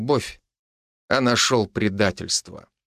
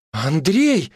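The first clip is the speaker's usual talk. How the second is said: higher-pitched cry of the name; startled cry; 5 semitones higher than usual. A narrator's reading. Wife is caught by her husband, startled cry. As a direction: call out in alarm, mildly. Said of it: "вскрикнула она".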